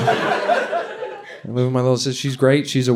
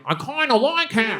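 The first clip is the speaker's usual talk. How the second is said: put-on higher, slower girlish voice; teen girl confession; 9 semitones higher than usual. Comic imitating sister's friend, higher and girlish. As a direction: coo it like a teen with a crush. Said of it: "I hear one of them be like".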